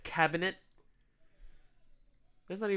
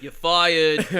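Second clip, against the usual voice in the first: louder catchphrase firing, mock Trump; Trump impression; 8 dB louder than usual. A co-host mock-firing Americans, loud Trump voice. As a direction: bark the firing like a game-show boss. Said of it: "your Donald Trump accent".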